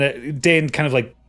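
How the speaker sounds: ordinary talk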